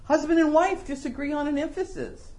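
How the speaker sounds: ordinary talk, nothing marked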